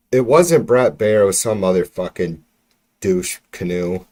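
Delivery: ordinary talk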